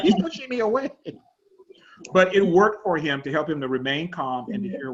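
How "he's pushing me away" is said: imitating the wife. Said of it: "she's like".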